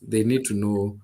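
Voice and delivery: ordinary talk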